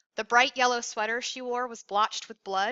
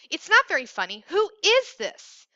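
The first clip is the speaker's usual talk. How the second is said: much higher and louder, slowing on who is this; angry demand; 8 semitones higher, 8 dB louder than usual. A narrator's reading. Andy loudly demanding the caller's name, angry. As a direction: shout the question at him. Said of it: "he demanded".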